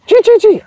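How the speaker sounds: high squeaking rat noise. Retold or imitated. Preacher imitating the dying rat, high squeak. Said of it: "the rat makes a noise".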